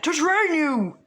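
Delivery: high, loud triumphant declaration; heroic shout. Pretend. All Might telling Izuku he will train him, loud heroic shout. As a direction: announce it proudly as the buffed-up hero. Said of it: "he buffs up and he's like".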